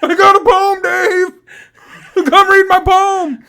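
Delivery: high, drawn-out bellow imitating a drunk heckler; very loud, insistent mock yelling; drunken fan yelling. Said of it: "he starts yelling".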